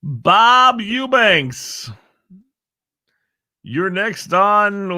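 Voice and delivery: slow, high announcer voice; game show host. Pretend. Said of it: "That's Bob's name".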